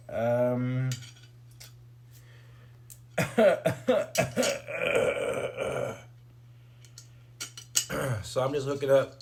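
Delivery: ordinary talk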